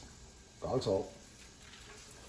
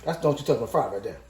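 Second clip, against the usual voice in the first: ordinary talk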